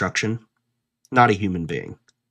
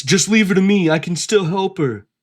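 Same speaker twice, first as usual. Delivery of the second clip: higher, earnest mock plea; mock Reed; 10 semitones higher than usual. Mocking Reed's promise to V, higher and pleading. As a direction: ham up his fake concern. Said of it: "When he says".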